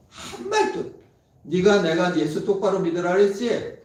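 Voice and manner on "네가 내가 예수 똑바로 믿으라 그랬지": loud, high reproach; mock scolding. Parents scolding their dying son, loud reproach. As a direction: rebuke the dying son sharply. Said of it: "이런 야단을".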